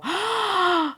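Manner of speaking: big high-pitched gasp; sharp intake of excited surprise; delighted gasp. Real, not acted. Unboxer spotting a cute detail on the toy, high delighted gasp. Sees the toy's hidden detail.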